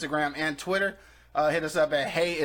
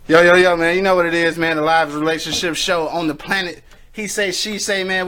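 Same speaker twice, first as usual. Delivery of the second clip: loud, energetic host opener; hype show intro; 8 dB louder than usual. Acted. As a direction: pump up the crowd as host.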